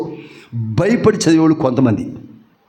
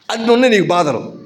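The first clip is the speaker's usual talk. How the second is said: ordinary talk, nothing marked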